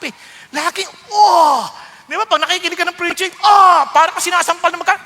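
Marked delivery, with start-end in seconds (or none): 1.1-1.6 1 pained gasp. Retold or imitated. Being stung by the word. 3.4-3.9 1 pained gasp. Retold or imitated. Stung while hearing preaching.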